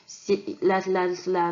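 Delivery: ordinary talk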